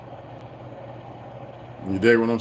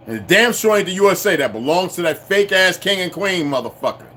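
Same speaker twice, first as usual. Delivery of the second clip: ordinary talk, nothing marked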